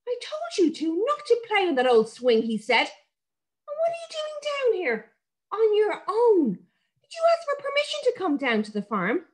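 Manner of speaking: put-on a dad telling off his children; cross father. A narrator's reading. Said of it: "an angry voice".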